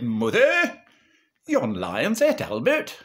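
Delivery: high, loud Northern father calling out; mock-alarmed Lancashire husband. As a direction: call to Mother with the bad news. Pa breaks news to Mother.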